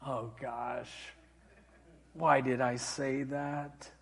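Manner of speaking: low, soft, slow regret; retold tearful murmur, sinking low; tearing up. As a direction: imitate an old mother near tears, very quiet. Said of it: "she starts tearing up like".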